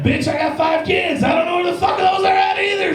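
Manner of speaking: loud mock fury at the clerk; exasperated yell. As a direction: yell in disbelief.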